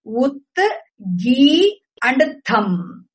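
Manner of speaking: "Ut, Gi, and Tham" as three separate beats; syllable by syllable. Shown as a demonstration. Teacher showing what the word consists of, syllable by syllable. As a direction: leave a clear gap after "Ut" and "Gi". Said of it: "consists of three syllables".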